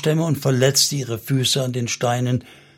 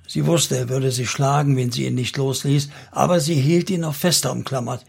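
ordinary delivery, nothing marked

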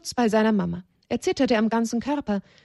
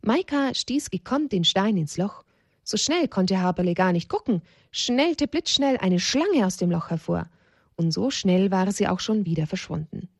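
ordinary delivery, nothing marked

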